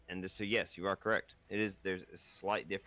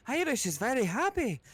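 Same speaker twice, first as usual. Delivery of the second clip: happy Irish voice; 4 dB louder than usual. Shown as a demonstration. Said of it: "the Irish are happy".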